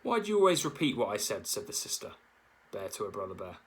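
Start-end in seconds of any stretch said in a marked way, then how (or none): 0.0-1.5 annoyed scolding. A narrator's reading. Sister bear scolds brother.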